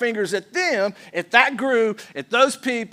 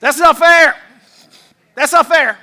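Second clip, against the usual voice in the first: put-on loud, high kid's protest; complaining child; 6 semitones higher, 12 dB louder than usual. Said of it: "Every single child has uttered these words".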